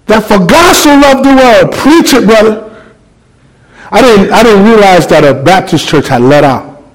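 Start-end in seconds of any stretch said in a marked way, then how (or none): 0.0-1.7 high, ringing preacher's cry; preaching fired up. Retold or imitated. Preaching to a packed train. 1.7-2.6 high encouraging call from the crowd; church amen. Retold or imitated. Baptist crowd egging him on.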